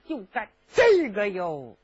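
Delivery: ordinary delivery, nothing marked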